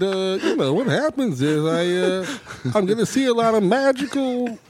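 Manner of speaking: lightly voiced spacey stoner; Jeff Bridges impression. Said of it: "Bridges telling Cage".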